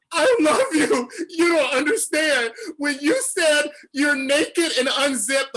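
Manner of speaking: high, loud, tearful gushing; tearful fan shout. Retold or imitated. Fan shouting his love at the model, near tears. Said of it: "on the verge of tears".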